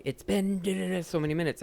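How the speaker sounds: high-pitched mock complaint; imitated irate customer. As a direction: mimic a diner yelling about the wait. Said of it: "I would start screaming back".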